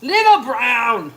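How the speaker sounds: high, loud coo, stretching "Little brown". Pretend. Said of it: "let me see the brown".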